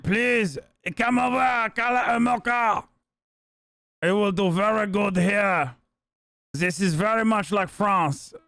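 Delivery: slow, hammed-up French accent; mock French accent. Streamer imitating French Canadian officials, slow mock French accent. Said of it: "They're like".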